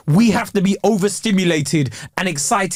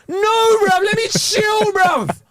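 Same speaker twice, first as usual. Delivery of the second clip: exasperated outburst; 11 semitones higher, much slower than usual. Real, not acted. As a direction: burst out begging to relax. Mock protest at own habit.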